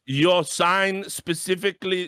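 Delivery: slow, laboured mock lecture; imitating ESL producer. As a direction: drag out each point pedantically. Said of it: "twenty minute fucking lecture".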